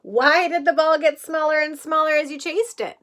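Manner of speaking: ordinary talk, nothing marked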